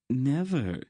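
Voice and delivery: cold, final verdict; firm finality. Acted. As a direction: shut it down with "Never". Queen confirms with finality.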